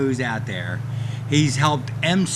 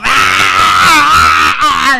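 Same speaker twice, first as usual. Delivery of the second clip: startled yell; 10 semitones higher, 13 dB louder than usual. Retold or imitated. Startled, jumping off in catering.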